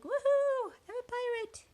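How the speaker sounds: high, playful whoop; excited cheer. Pretend. Reader voices the boy as new pirate, excited squeal. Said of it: "He's so excited".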